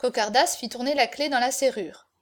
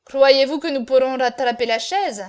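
loud, irritated challenge; bad-tempered; 8 dB louder than usual. A narrator's reading. Cocardas doubts they can catch the chaise, bad-tempered. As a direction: object loudly and grumpily. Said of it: "avec mauvaise humeur".

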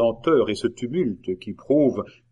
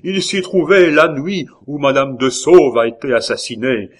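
loud, raised-pitch insistence; insistent cry; 5 semitones higher, 8 dB louder than usual. A narrator's reading. La Hurière offers proof to doubters, crying out. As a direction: press the proof forcefully on the crowd. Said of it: "s'écria la Hurière".

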